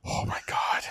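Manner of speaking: hushed, voiceless disbelief; dismayed whisper. Real, not acted. Friends realizing they are old, dismayed whisper.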